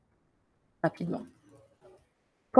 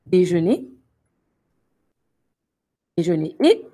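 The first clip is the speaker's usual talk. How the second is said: déjeuné said clearly and louder, each syllable distinct; careful pronunciation; 4 dB louder than usual. Shown as a demonstration. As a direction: stress déjeuné, loud and careful. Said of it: "attention aux accents, regarde bien".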